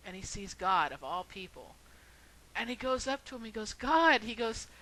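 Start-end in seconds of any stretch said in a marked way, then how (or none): 3.7-4.2 loud, high call to God; indignant cry up to God. Retold or imitated. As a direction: shout his name in protest. Calling out to God in heaven.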